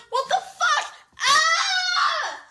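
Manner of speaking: very high loud panicked cry; scared scream. Retold or imitated. Chased down the street by the truck, panicked scream. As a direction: shriek it like you're being chased. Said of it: "we're like".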